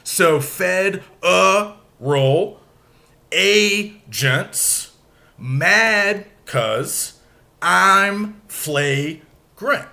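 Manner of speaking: slow, syllable by syllable, stresses hit; "fed a roll" split and scanned slowly; broken into iambs. Shown as a demonstration. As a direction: tap out each iamb. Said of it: "let's break it down going unstressed to stressed".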